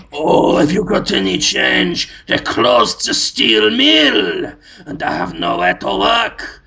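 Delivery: slow, pleading down-and-out beggar; mock beggar. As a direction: whine it like a poor street musician. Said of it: "and he's just".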